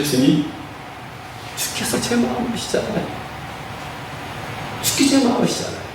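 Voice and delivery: soft, breath-heavy plea as an ashamed Peter; 부끄러운 고백. Acted. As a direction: confess it timidly, voice nearly dropping to a whisper. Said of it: "너무 부끄러운 거예요". (부끄러워서 기어들어 가는 작은 목소리)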